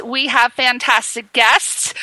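ordinary delivery

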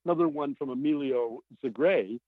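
normal talking voice

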